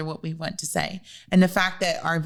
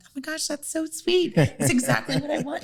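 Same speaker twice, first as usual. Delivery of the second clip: high, gushing mock delight; squealing happily at his surprise; 10 semitones higher than usual. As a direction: gush like it's the sweetest thing. Retelling her delighted reaction.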